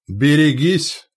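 loud, high, drawn-out warning; urgent stretched cry; warning shout. A narrator's reading. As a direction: call danger across the position. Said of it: "донёсся голос Яриловича".

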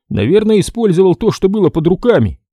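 обиженный дядька, голос выше; обиженно сопя, оправдывается. Acted. Ермоленко продолжает. (хозяин обиженно отвечает рассказчику, голос выше)

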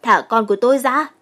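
acted shout to release her son; loud yell. A narrator's reading. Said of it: "liền hét lớn".

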